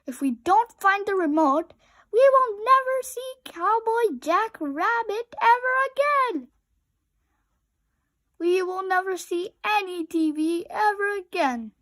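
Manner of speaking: high, loud frantic wailing; panicked wail. A narrator's reading. Rabbit panicking that TV is lost forever. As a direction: despair at full volume.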